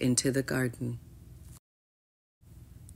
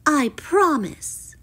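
high, drawn-out promise; pitched-up princess voice; falsely agreeing princess; 9 semitones higher, slower than usual. A narrator's reading.

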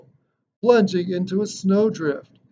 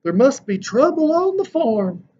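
put-on higher, different voice for the old doctor; Doc's voice; 9 semitones higher, 4 dB louder than usual. A narrator's reading. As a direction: voice Doc surprised at the window. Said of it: "Doc said".

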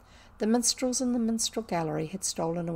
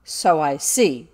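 slow, loud, lower-pitched scorn; withering; 4 semitones lower, 11 dB louder, slower than usual. A narrator's reading. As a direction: draw it out with heavy contempt. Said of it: "said Miss Minchin witheringly".

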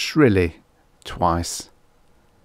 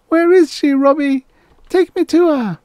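put-on very high, loud child voice; pleading little girl; 7 dB louder than usual. Little girl asks robot for help, pleading. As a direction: plead like a small lost child.

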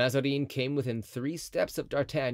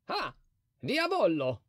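exclaiming; 8 semitones higher than usual. A narrator's reading. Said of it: "he exclaimed".

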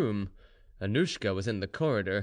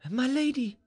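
high, urgent exclamation; sudden cry; 13 semitones higher than usual. A narrator's reading. Maid to her mistress on finding a plan, sudden cry. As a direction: call out with sudden hope. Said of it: "she cried suddenly".

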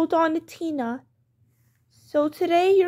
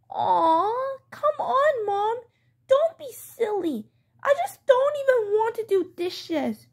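put-on higher, pleading kid whine; whining child; 4 semitones higher than usual.

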